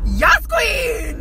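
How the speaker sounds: loud high shout of praise; loud high cheer. Acted. Cheering within the ad.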